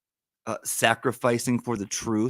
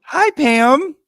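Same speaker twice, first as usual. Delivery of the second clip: high, loud happy hello; excited greeting; 14 semitones higher, 10 dB louder than usual. Real, not acted. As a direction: greet a dear friend with a big burst. Greeting a caller happily.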